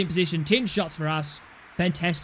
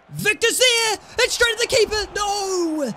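high, loud near-miss shout; excited commentary yell; 13 semitones higher, 3 dB louder, slower than usual. Real, not acted. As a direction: yell the chance, then groan No. Shot saved.

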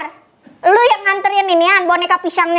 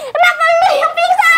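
laughing; 9 semitones higher than usual. Acted. Mock fight dissolving into laughter.

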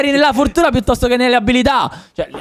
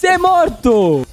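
"Sei morto." drawn out slowly, each word weighed; slow declaration; 6 semitones higher, much slower than usual. Real, not acted. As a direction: spell out the verdict slowly. Teasing the teammate about dying. ("Sei morto." scandito lentamente)

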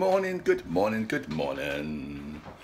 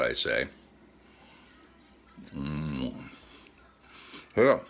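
chewing between words; savoring candy while talking; mouth partly full; 3 semitones lower, 3 dB quieter than usual. Real, not acted. Seen 0: bitten chocolate candy in hand, lips pressed between phrases.

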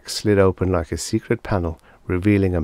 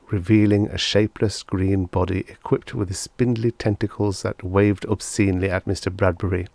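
plain reading voice

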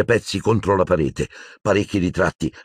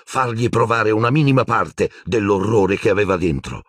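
plain reading voice

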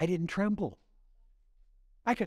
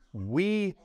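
"We" drawn out with swelling pitch; stress on We; 3 semitones higher than usual. Shown as a demonstration. Preacher pointing out a word in Isaiah, stressing "We". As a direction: lean long and hard on "We". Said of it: "did you catch this?".

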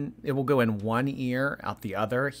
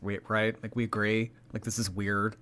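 usual speaking voice

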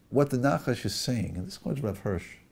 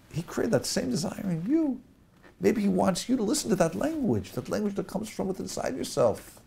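high, loud, urgent serpent pleading with Eve; the snake coaxes Eve to follow desire, loud and high; tempting snake; 8 semitones higher, 5 dB louder than usual. Acted. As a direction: press Eve like a persuasive snake. Rabbi voicing the snake tempting Eve.

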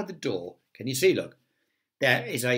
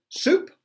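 "Soup" sung out high and loud; playful emphasis; 7 dB louder than usual. A narrator's reading. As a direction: make "Soup" leap up high. Listing supper, playful emphasis.

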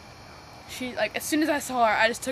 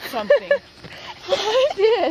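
laughing; 9 semitones higher, 8 dB louder, slower than usual. Real, not acted. Laughing while admitting it.

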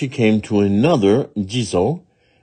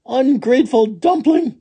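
high, louder scolding cry; 5 dB louder than usual. Acted. Little woman to the runaway dumpling, high scolding. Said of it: "scolded the little woman".

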